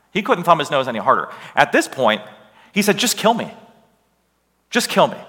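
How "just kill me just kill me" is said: pitched-up, fed-up outburst; hammed-up exasperation, each plea set apart; exasperated demand. Jonah to the sailors, refusing Nineveh, acted exasperation.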